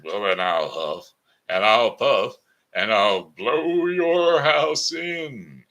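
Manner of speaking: loud, slow wolf threat; big bad wolf threat. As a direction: stretch out the threat like a menacing wolf. Wolf threatens to blow house.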